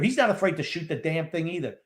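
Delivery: ordinary talk, nothing marked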